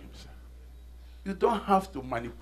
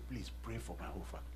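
fast, soft whisper; whispered plea; 7 semitones lower, 19 dB quieter, much faster than usual. Retold or imitated. Brother asking the pastor to pray, whispered. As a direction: hush it like a secret request. Brother's humble request retold.

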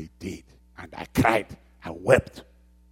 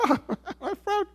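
incredulous laugh; slower than usual. Retold or imitated. Incredulous reply to God.

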